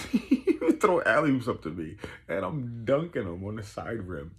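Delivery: high, loud, laughing retelling; laughing excitement. Real, not acted. Man reliving alley-oops from his coach, laughing excitedly. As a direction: burst out laughing and repeat it loudly, high.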